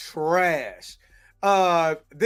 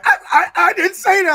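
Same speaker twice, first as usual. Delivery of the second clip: imitating a flustered stammer, much higher and louder; high stammering denial; 11 semitones higher, 7 dB louder, faster than usual. To friends, mimicking Deadpool's flustered denial, high and stammering. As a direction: squeak out the denial like a caught kid. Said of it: "the way that he was like".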